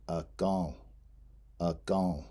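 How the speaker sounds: akan slow, stressed a, nasal vowel before the end; nasalized akan. Shown as a demonstration. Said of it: "that in is nasalized".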